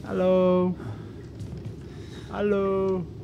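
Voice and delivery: high, held-tone hello; calling out. Real, not acted. Player calling to whoever may be there, high drawn-out call. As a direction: sing out a wary hello, last vowel held.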